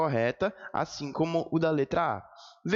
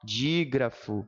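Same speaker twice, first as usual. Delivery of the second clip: slow, lower, carefully spaced term; nome destacado; 5 semitones lower, much slower than usual. Shown as a demonstration. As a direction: present Dígrafo slowly as a new term. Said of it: "Olha o nome". (devagar e bem articulado)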